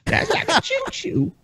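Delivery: very high, squeaky silly voice. Acted. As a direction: squeak it out like a cartoon. High silly reply, far.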